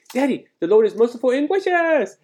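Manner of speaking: put-on very high, loud toddler voice; shrill little boy. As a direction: pipe it out like a proud small child. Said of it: "with such a shrill little voice".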